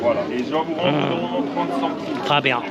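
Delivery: put-on joking French accent, rising at the end; mock French accent. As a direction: say it like a playful Frenchman. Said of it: "giving me the French accent".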